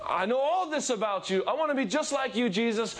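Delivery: high-pitched eager calling to Jesus; gushing fan. Retold or imitated. Believer calling to Jesus like a crazed fan, high. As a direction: call out like a starstruck fan. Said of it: "You standing at the side of the field saying".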